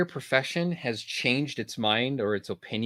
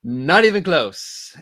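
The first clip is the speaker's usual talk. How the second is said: laughing it off, slow; 7 semitones higher, 6 dB louder, slower than usual. Real, not acted. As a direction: chuckle through the words. Laughs at own Pfizer comparison.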